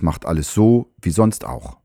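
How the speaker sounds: ordinary talk, nothing marked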